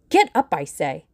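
loud, angry acted order; impatient command. A narrator's reading. Villain stamps and orders girl up.

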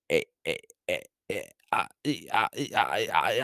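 real stuttering. Real, not acted. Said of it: "I'm fucking stuttering right now".

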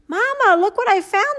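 a lightly voiced excited little boy; boy calling out. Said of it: "the boy called out".

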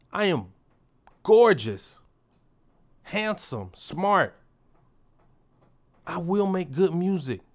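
loud, fired-up street swagger; shouting affirmations at himself in the mirror; ratchet affirmation. Shown as a demonstration. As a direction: declare it like a boss, loud and proud. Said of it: "excuse the ratchetness".

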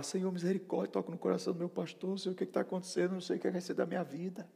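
high, pleading retold prayer; desperate prayer. Praying over the pastor's rejection, retold desperation. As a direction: act out begging God for mercy. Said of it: "Eu fiquei desesperado".

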